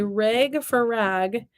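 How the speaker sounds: first "rag" like reg, second "rag," with short a; Wisconsin rag versus standard. Shown as a demonstration. Counselor shows Wisconsin speech, "rag" as reg then standard "rag,". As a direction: contrast "rag" as reg with "rag," as in cat. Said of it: "They say rag for rag".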